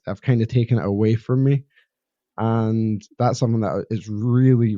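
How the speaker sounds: ordinary delivery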